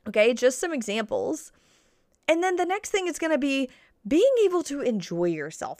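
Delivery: ordinary talk, nothing marked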